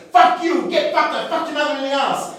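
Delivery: high, over-eager shouted chant; drunk, excited yell. Retold or imitated. Drunk colleague turns to guy next to him, excited shout. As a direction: bellow it high with misplaced gusto. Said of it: "he was so drunk, but so excited".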